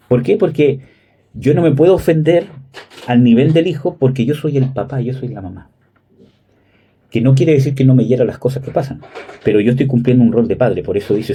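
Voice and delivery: normal talking voice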